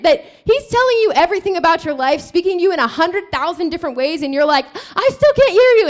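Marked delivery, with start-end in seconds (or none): 5.0-5.9 high, loud whiny protest; raised, overdone complaint; mock exasperated cry. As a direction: imitate someone hard-headed crying out. Voices the hard-headed believer.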